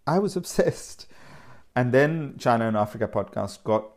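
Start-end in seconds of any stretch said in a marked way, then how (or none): 0.1-1.0 laughing, higher. Real, not acted. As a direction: laugh through it.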